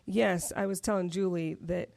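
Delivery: usual speaking voice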